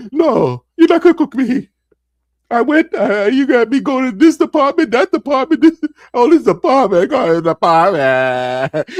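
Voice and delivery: hammed-up, high-pitched tearful rant; voice cracking into crying. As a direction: break down mid-complaint, wailing comically. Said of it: "And then I start crying".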